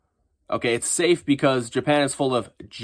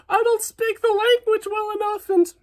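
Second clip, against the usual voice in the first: high, whiny mock panic; mock crying voice. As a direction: squeal it like a hammed-up crybaby. Mocking panicked foreigners.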